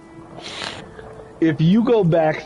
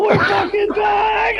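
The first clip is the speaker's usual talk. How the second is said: high, slow excited shout; high, slowed joyful fan shout; freaking out. Retold or imitated. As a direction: shout it out like a fan whose team is back. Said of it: "I was freaking out".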